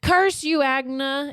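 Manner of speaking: high, loud melodramatic curse; mock freaking out. Rissa cursing the woman who set her up, mock freak-out. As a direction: cry it out like a hammed-up heroine. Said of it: "she's just freaking out".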